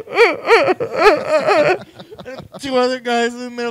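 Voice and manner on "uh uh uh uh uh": high, loud blubbering stammer; mock sobbing. Crying kid left outside, high mock sobbing. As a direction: imitate a crying kid's sobs.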